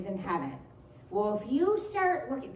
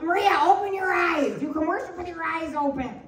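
sister telling off; 6 semitones higher than usual. Retold or imitated. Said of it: "tell me off".

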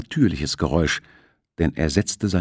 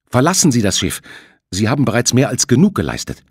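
loud, higher urging; urgent; 5 semitones higher, 6 dB louder, faster than usual. Acted. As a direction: insist he leave now. Shipowner urges captain.

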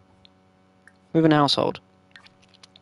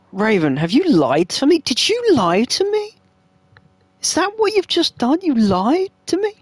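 mock outrage; 8 semitones higher than usual. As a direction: play scandalized, voice climbing high.

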